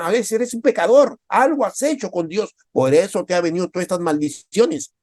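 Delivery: high, loud reproach; accusing friends. Retold or imitated. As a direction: condemn him as a sinner. Friends labelling Job a sinner.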